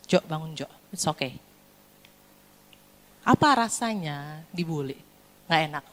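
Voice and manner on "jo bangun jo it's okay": soft, low parental reassurance; comforting presence. Acted. Parent comforting a fallen son, soft and caring. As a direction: drop low and gently lift him up. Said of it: "bisa dengan kasih hadir".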